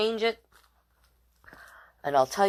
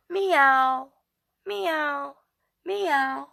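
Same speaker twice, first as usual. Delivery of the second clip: high playful meowing; lightly voiced kitty meow; kitty cat meows; 8 semitones higher, much slower than usual. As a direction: meow like a kitten for the baby. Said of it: "I say".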